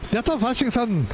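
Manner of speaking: lightly put-on German accent; bad German accent. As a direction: greet the crowd like a cartoon German. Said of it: "Now I'm doing German, I think".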